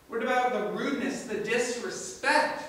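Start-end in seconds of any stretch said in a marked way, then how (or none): none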